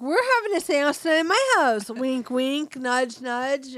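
high, loud, rushed opening; mock eager announcement. Wife hinting to husband about tonight's seance, mock eager announcement. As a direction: push the hint with playful emphasis.

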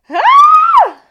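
very high loud shriek. Retold or imitated. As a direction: scream in disgust. Moth memory.

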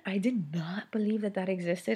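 hushed confessional whisper. Real, not acted. Mom admitting to viewers she doubted mom brain, whispered. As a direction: whisper it like a secret.

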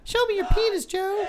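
falsetto. Pretend. Falsetto joke voice.

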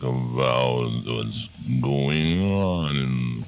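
very slow, drawn-out voice; slow motion. Acted. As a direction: drag it out like a dying tape. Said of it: "You just made it so much worse".

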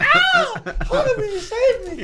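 high squeal broken by laughter; laughing mock shriek. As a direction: shriek it and crack up. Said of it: "You're like".

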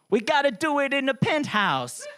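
high put-on voice, slow on the pen line; mock Bruno boast. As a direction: ham up a cocky footballer's boast.

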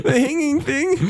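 high cry of laughter; laughing. Real, not acted. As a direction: squeal it while laughing. Laughing through the words.

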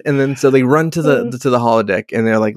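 normal talking voice

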